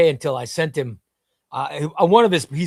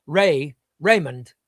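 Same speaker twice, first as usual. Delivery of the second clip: ordinary delivery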